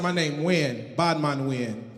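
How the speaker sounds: low, soft mock Jamaican tough guy; bad man Wayne persona. Comic introduces his fake island persona, low mock Jamaican tough voice. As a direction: drop low and growl the patois name. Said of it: "came up with this persona".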